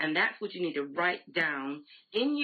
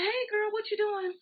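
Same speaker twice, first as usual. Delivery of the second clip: chirpy greeting. Retold or imitated. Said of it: "You see somebody say".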